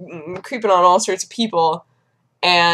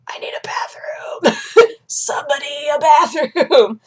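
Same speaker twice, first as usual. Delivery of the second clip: high, drawn-out mock desperation; mock panicked plea; 7 semitones higher, slower than usual. Bleeding patient begs for a bathroom, mock panic. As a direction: play up a strained cry for help, dragging out "bathroom".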